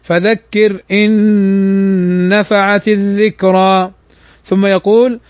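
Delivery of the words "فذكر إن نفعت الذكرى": إن نفعت with the نون merged and held nasal; idgham with ghunna. Shown as a demonstration. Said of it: "فيقرأها هكذا".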